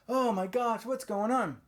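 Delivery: hammed-up alarmed cry; mock panic. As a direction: play a kid in a panic. Acting the panicked kids.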